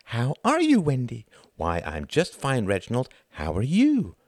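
mocking singsong child exchange; sarcastic little-boy mimicry; imitated little boy. A narrator's reading. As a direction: swoop up on the questions like a small boy. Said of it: "imitated a little boy".

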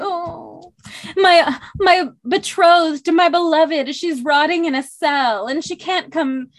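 high, loud, hammed-up wailing lover; mock woe-is-me lament. Friend mocks Tamlin pining for his lover, high loud wailing. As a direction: wail it like a melodramatic brooding hero. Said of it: "he's very much like, "Woe is me".

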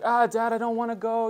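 put-on whiny toddler. Daughter begging dad at school, whiny toddler voice. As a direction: whine like a reluctant three-year-old. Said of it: "she's like".

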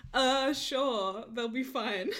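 laughing through quote. Retold or imitated. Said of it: "quoting Cass".

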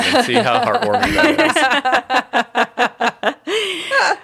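high, slow and laughing. Real, not acted. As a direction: laugh through the words. Laughing sarcasm.

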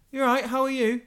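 high, bright mock-cheery greeting; chirpy greeting. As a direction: mimic an overly chipper colleague. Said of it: "And they just go".

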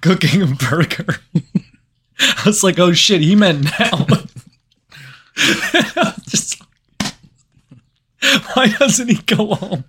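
high, loud, laughing through the words; can't hold back the giggles; laughing disbelief. Real, not acted. Driver cracking up telling cohost about the grilling manager, laughing high and loud.

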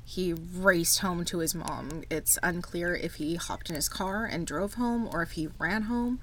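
ordinary talk, nothing marked

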